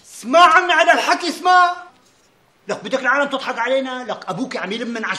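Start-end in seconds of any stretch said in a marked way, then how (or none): 0.0-1.8 loud, raised voice demanding a hearing; firm, loud insistence; insistent. Acted. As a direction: cut in higher and louder. Cutting in on family argument.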